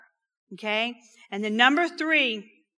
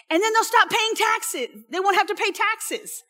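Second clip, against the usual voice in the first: excited soldier; 8 semitones higher than usual. Retold or imitated. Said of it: "soldiers list the rewards".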